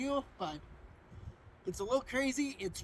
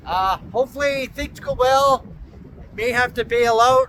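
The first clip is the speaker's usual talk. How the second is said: shouting over wind; 2 semitones higher, 17 dB louder than usual. Real, not acted. Outdoors in strong gusting wind.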